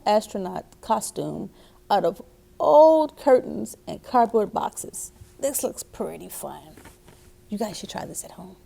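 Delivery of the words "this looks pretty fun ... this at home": fast, soft whispered aside. Real, not acted. Reader whispering to children to try it at home. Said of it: "whispered aside to listeners".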